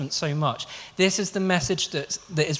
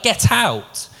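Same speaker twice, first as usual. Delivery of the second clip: louder, higher mock "get out"; mock scolding; 6 semitones higher, 7 dB louder than usual. Cartoon mama ordering the cat out, mock angry. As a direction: snap it like an annoyed mama. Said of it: "she would be like".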